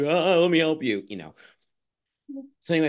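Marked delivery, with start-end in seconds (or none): 0.0-1.0 mock crazy crying person. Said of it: "the crazy crying person".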